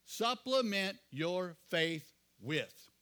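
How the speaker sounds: each word drawn out slowly; slow emphatic reading. Shown as a demonstration. Pastor stressing the scripture to the congregation: slow, deliberate emphasis. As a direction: spell it out word by word. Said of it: "You hear how we just added to faith?".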